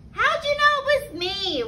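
high-pitched storybook wail; mock wailing. A narrator's reading. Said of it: "wailed Turkey".

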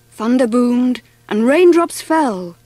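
plain reading voice

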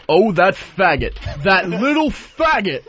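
hammed-up rage, pitched high; imitated angry outburst. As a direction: mimic a furious man exploding, drawing out each word. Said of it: "he comes storming out of his office".

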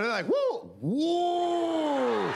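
ordinary delivery

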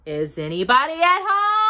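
high, rising call into a hole. A narrator's reading. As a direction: call to see who's in. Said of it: "called out".

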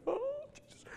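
very high, fluttery "Oh, Jesus"; hammed-up high swoon; swooning devout ladies. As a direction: imitate the adoring prayer ladies. Imitating devout praying ladies.

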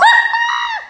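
very high squealing laugh; shrieking laugh. Real, not acted. Friends joking about the bag, shrieking laugh. Startled reaction to donut bag.

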